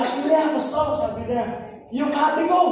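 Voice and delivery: imitated old man.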